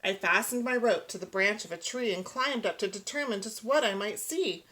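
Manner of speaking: usual speaking voice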